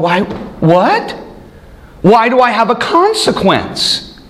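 high, slow, baffled whine; mock bewildered. Preacher voicing a shocked sinner, high baffled whine. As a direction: play the stunned sinner, drawn out.

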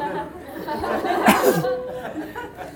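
breathy startled laugh; surprised laugh. Real, not acted. Dad laughing at his son's comeback, breathy.

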